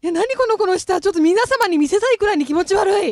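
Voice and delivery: excited. Real, not acted. Amazed at the tongue trick.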